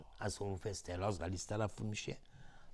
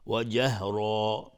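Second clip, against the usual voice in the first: جهرا slow, final aa held two beats; substitute lengthening; 5 dB louder, much slower than usual. Shown as a demonstration. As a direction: draw out the last vowel of جهرا. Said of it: "مد عوض".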